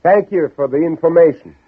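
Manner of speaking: calling out farewell. Acted. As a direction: call after him as he rides off. Calling farewell to departing rider.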